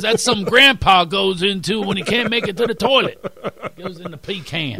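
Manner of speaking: mock Texan ridicule, milking the punchline; Texas accent.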